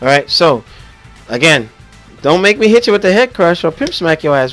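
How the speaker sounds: ordinary delivery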